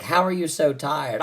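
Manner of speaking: usual speaking voice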